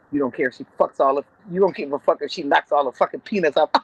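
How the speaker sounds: normal talking voice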